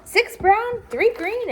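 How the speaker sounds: high, eager counting; kid counting. Acted. Tom counting aquarium rocks in a high, eager voice.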